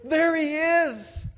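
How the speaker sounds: high, slow, ringing cry of joy; a father spotting his lost son far off; overjoyed father. Acted. Father spots his returning son, cries out in joy. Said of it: "and He's like".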